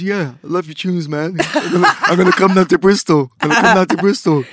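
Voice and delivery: loud, high-pitched impression of an excited caller; Brian G. Label boss phoning a young producer about his tunes, Brian G impression. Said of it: "that's my Brian G impression".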